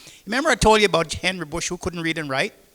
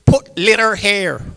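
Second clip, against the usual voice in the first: slow, louder broken-English reading; mock sign reading; 3 semitones higher, 8 dB louder, slower than usual. Henry's can sign read out slow and louder. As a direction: spell out the sign slowly. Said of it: "pan, say".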